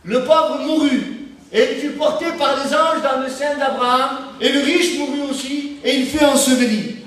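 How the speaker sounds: solemn Bible reading from the pulpit; scripture reading. Acted. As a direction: lift the voice and read the verse like scripture. Reads the gospel passage aloud.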